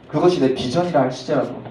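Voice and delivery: normal talking voice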